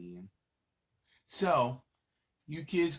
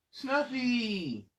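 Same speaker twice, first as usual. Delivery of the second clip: excited call; 11 semitones higher, 4 dB louder than usual. Acted. Calling out the character's name.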